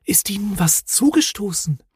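high, whispery, frightened voice; anxious. A narrator's reading. Snork maiden questioning the Hemul, anxious and whispery. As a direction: ask timidly in a high, airy whisper. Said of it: "fragte das Snorkfräulein ängstlich".

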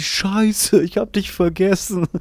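imitating Marion. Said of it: "Und sie".

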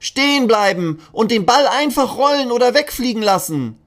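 high, loud chanting class; children's chorus. Acted. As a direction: recite it together like eager kids. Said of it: "sagen die Kinder im Chor".

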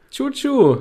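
high, loud choo-choo; train whistle sound. Pretend. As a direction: toot like a toy train.

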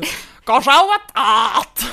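slow, loud drawn-out call; Doktor Lüdi. Retold or imitated. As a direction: belt it out slowly like a showman. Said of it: "wo er halt auch so sagt, so". (langsamer, lauter Ausruf)

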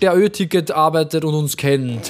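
slow, drawn-out grand appeal; playful incantation to a ticket seller; mock-solemn summons. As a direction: call upon a listener with mock gravity. Mock-solemn appeal to unknown listener.

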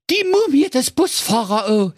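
high, terrified cry with MUMIE stressed; panicked cry. Acted. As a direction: blurt it out in fright. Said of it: "schrie in heller Angst". (ängstlicher Ausruf)

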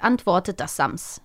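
usual speaking voice

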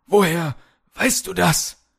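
high, startled question; shocked. A narrator's reading. Polar bear leader caught out by Kalle, shocked. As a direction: ask it high-pitched, taken aback. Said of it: "fragte Kralle geschockt".

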